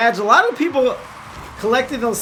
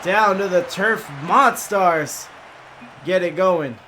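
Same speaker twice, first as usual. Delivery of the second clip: play-by-play; 3 semitones higher, 4 dB louder than usual. Acted. Live play-by-play casting.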